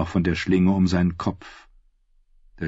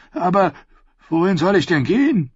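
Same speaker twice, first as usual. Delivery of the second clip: helpless protest; 10 semitones higher, 3 dB louder than usual. A narrator's reading. Dismissed knight protests helplessly.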